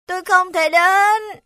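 high, slow whimpering cat; whining. Acted. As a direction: moan it out like a sly cat. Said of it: "ả rên rỉ". (giọng mèo rên rỉ)

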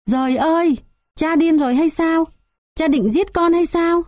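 high, panicked yell of terror; crying out. Acted. Son sees the knife and shrieks at his father in a high panicked voice. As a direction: shriek it out in disbelief. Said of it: "kêu toáng lên".

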